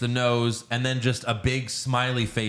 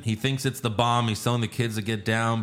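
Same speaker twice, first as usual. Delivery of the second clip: usual speaking voice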